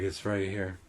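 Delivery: low, soft, unruffled voice; calming reply. Retold or imitated. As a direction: answer the shrieking child quietly. Calm reply to screaming child.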